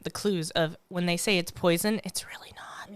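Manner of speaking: hushed secret-telling whisper; conspiratorial whisper. Acted. Adults disclosing secrets to kids.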